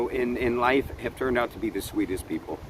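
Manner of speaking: usual speaking voice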